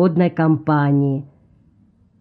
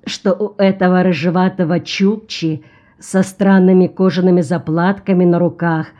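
ordinary delivery, nothing marked

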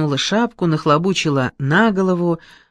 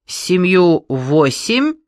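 slow stern quiz; stern examiner; 3 semitones higher, 5 dB louder, much slower than usual. Acted. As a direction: fire it slowly like an examiner. Boss quizzes the girl.

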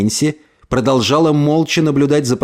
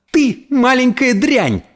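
high, slow, drawn-out angry cry; piercing furious cry; 11 semitones higher, slower than usual. A narrator's reading. Mother turns on the girl who hurt her son, piercing cry. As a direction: cry out in a voice not your own. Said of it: "крикнула она не своим голосом, громким, пронзительным, дрожащим".

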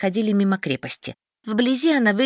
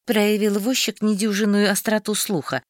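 plain reading voice

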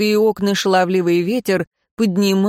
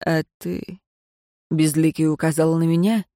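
cold dismissal; 2 semitones lower than usual. Acted. Безликий dismisses heroine.

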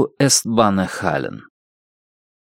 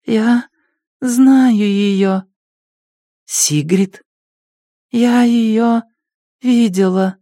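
junkie voice; 11 semitones higher, much slower than usual. Acted. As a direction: blurt it out up high. Junkie claims to know sister.

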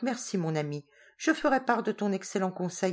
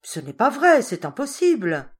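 louder, higher burst of protest; cried out; 5 semitones higher, 7 dB louder than usual. Acted. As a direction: cry out against the idea. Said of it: "s'écria".

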